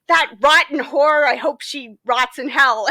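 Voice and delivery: loud, spiteful snarl; bitter outburst. Retold or imitated. Grieving old woman cursing her friend, spitefully. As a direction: spit out the curse. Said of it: "she suddenly goes".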